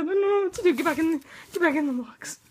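loud, panicked, rushed command; frantic. Real, not acted. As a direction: order it back in a fast panic. Frantically ordering pet into box.

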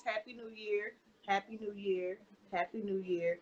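low, soft, polite retold greeting; being nice. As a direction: repeat it politely, low and understated. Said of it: "So I'm being nice".

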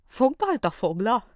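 slow rising "Fogdalt a fogla?"; puzzled question. A narrator's reading. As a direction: echo the odd words back, confused. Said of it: "kérdezte a hang".

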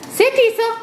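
very high, bright dog command; pet voice command. Real, not acted. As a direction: praise the dog.

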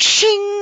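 high, loud ringing "shing"; sword-drawing noise. Retold or imitated. Player draws his new sword, high ringing imitation. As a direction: ring out like a drawn blade.

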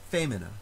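"femina" with FE stressed; stress on first syllable. Shown as a demonstration. Teacher demonstrating the stress rule, first-syllable stress. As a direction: hit the FE of "femina", short light middle. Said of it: "the stress moves forward to the previous syllable".

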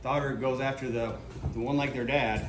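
ordinary delivery

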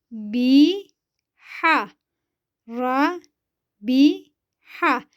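each syllable said alone with a pause after it. Shown as a demonstration. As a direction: spell out the word slowly for pupils to repeat. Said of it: "Ben okuyorum".

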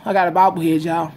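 usual speaking voice

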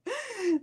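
a short high burst of laughter; helpless giggle. Real, not acted. Laughing at chat comment.

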